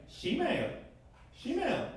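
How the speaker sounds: "Shemale" with sh for the Spanish y; mock Argentine English. Clients spelling her email, Argentine accent. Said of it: "they're like".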